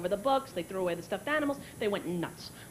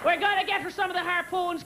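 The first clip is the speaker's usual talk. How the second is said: higher, hearty mock-proud father; bragging dad; 6 semitones higher than usual. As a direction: imitate dad boasting to a neighbor. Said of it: "he's bragging to the neighbors".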